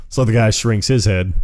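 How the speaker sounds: ordinary talk, nothing marked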